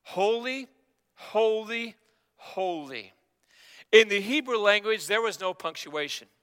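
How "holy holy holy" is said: drawn-out, measured holy cry, final word dropping; crying out in worship. Retold or imitated. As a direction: intone each word slowly, falling on the last. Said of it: "And they were crying".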